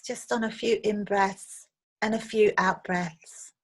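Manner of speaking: unhurried meditation guide, long pauses between phrases; guided meditation. Acted. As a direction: gently lead listeners through a visualization, leaving space after each phrase. Leads a guided visualization meditation.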